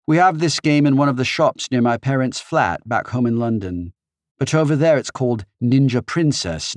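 put-on chatty British girl, London accent. A narrator's reading. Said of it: "in her British accent".

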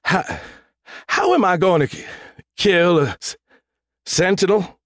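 high, halting stammer; quivering voice. A narrator's reading. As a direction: stammer in dread, breaking off words. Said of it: "Thor's voice quivered".

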